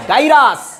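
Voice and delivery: गायरस said clearly in two beats, "gai-ras"; pronunciation model. Shown as a demonstration. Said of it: "pronounce करो".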